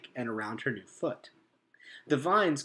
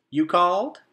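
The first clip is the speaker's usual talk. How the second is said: lightly voiced, lilting woodpecker; a sing-song reply; musical voice; 6 semitones higher, 9 dB louder than usual. A narrator's reading. Trunk Tap answering the beaver's call, lilting. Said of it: "the woodpecker's musical voice".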